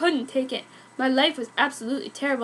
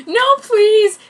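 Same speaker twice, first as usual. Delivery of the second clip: much higher, louder begging; desperate plea; 9 semitones higher, 11 dB louder than usual. Acted. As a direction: beg her not to leave.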